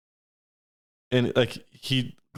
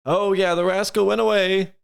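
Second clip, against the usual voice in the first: high, slow call to the guard; gnome covering up; 8 semitones higher than usual. Acted. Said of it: "Umbral Gnome looks over and goes".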